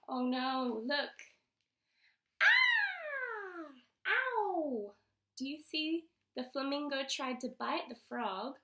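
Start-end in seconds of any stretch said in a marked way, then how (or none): 2.4-4.9 high, drawn-out "aah", then a falling "ow"; pained cry. Acted. Acting out a bitten leg for a child, mock pained cry. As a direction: cry out as if nipped. Frog and flamingo bite, acted.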